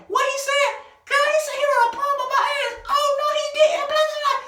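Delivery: screechy, very high imitation; mock outraged sister. Sister jumps into the quarrel, high mock outrage. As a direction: shriek in disbelief like an offended sister.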